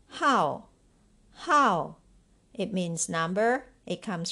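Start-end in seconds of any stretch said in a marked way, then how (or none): 0.1-2.0 hao said loud, twice; model pronunciation. Shown as a demonstration. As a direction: model the word hao for learners to repeat. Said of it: "Number five".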